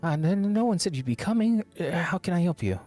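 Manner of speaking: high, startled and stammering; flustered surprise. Acted. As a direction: caught off guard, flustered. Startled artisan caught off guard.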